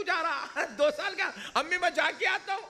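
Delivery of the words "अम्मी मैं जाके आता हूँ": high, quick mock-timid son; mock fearful. Son nervously telling his mother he'll be back, mock fearful. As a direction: act out a nervous goodbye, pitched high. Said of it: "इतना डरा हुआ है".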